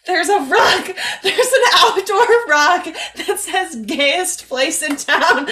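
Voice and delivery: can't hold back the giggles; laughing excitedly. Real, not acted. Showing her co-host the rug while laughing, excited.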